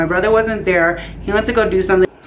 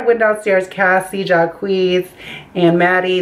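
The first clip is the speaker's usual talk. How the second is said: ordinary delivery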